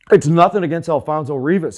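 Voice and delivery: loud frustrated sports rant. Real, not acted. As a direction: let it rip at the team's bosses. Ranting about the Cubs.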